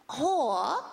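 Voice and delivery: lightly put-on princess voice; hint of a foreign accent; mock Japanese accent. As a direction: offer it like a tiny royal. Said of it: "she goes like this".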